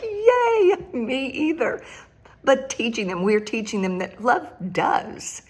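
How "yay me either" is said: squealing high yay; excited yay. Retold or imitated. Grandma answering granddaughter's call, squealing with joy. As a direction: jump way up in pitch with glee. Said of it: "I'm like".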